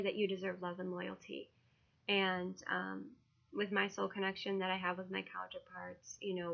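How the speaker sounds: ordinary talk, nothing marked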